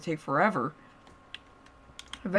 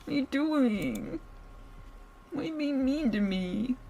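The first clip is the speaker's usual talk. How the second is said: hurt pleading; 6 semitones higher, 5 dB quieter than usual. Acted. Captive pleading with the man holding her, hurt. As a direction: plead with him, wounded.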